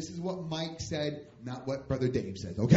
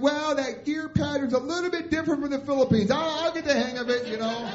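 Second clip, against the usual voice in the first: high, loud, hammed-up excuse; shouted excuse; 11 semitones higher, 6 dB louder than usual. Driver on radio excusing gear trouble, raised voice. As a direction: call out a breezy excuse, voice raised.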